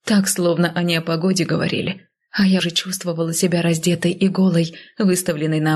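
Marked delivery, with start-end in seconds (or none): none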